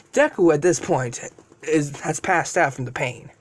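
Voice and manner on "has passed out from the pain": usual speaking voice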